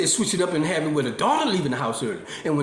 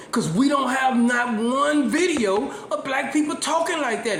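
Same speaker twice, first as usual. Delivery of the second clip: emphatic; 6 semitones higher than usual. Real, not acted.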